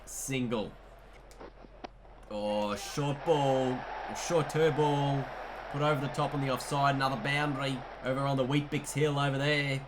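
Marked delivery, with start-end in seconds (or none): none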